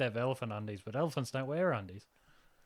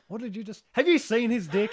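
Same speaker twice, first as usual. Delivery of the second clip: louder, higher, incredulous blurt; mock outraged protest; 7 semitones higher, 7 dB louder than usual. Nurse defending herself to patient's family, mock outraged. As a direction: protest it loudly through rising laughter.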